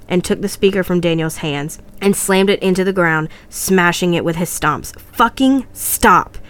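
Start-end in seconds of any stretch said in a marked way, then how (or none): 5.1-6.4 higher, slow, hammered-out yell; screamed. Acted. James smashes the whispering speaker, yelling. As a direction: yell each word, short of a full scream. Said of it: "he screamed".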